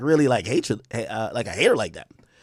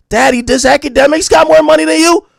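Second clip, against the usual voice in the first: put-on higher, louder kid's question; mock child; 9 semitones higher, 7 dB louder than usual. Son asks his dad about Academics, in a mock child voice. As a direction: ask like a wide-eyed little boy. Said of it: "his son came to him and said".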